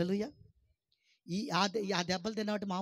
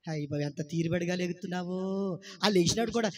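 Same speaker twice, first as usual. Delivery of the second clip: usual speaking voice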